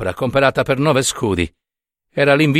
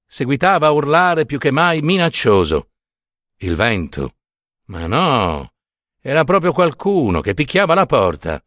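usual speaking voice

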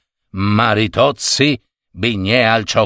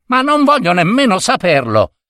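higher-pitched, cutting comeback; dismissive retort; 6 semitones higher than usual. A narrator's reading. Shop assistant to the protesting gentleman, dismissive retort. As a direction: brush off the gentleman's boasting.

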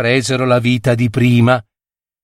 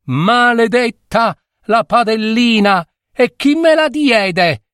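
crying out a curse; 10 semitones higher than usual. A narrator's reading. Girl cursing the little pan, crying out. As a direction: curse it in a high outcry. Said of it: "e gridava".

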